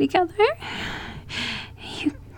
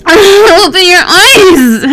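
higher, louder, slow crying yell; tearful shout; 7 semitones higher, 9 dB louder, slower than usual. Acted. Desperate lover screaming at her partner through tears.

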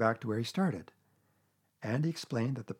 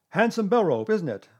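loud, high-pitched showing off; proud Owl; 7 semitones higher, 8 dB louder than usual. A narrator's reading. Owl showing Pooh the bell rope, proud. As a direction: show it off in a raised voice.

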